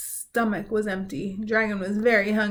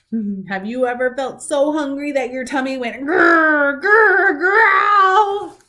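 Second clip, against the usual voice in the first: playful tummy growl; 6 semitones higher, 8 dB louder, slower than usual. Real, not acted. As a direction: growl jumps high and loud. Asking kids, tummy growl.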